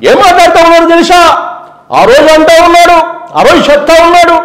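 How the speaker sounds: high, loud public-meeting delivery; loud rally voice. Retold or imitated. Jagan impression shouting at a rally. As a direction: hammer it at the crowd. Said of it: "గట్టిగా మాట్లాడతారు".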